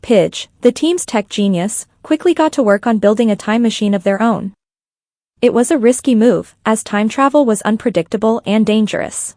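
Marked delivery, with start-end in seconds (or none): none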